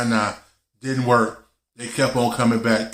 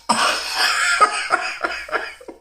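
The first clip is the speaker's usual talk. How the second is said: airy high-pitched giggle; high breathy laugh. Real, not acted. Laughing at dad's yell.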